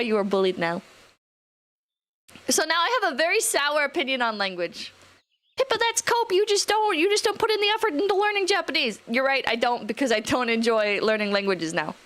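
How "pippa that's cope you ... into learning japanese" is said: high-pitched, hurried mock scolding; mock accusing. Streamer voices a chatter scolding her over Japanese, mock accusing. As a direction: imitate a nagging critic, pitched way up. Acts out a scolding chat message.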